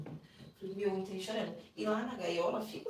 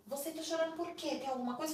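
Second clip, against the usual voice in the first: retold suspicious questioning; 8 semitones higher than usual. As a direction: imitate an interrogating guard, pitched up. Guard repeats the suspicious question.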